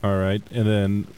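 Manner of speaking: ordinary delivery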